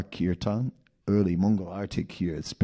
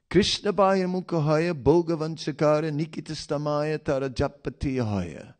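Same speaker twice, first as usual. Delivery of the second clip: verse recitation; 6 semitones higher than usual. Acted.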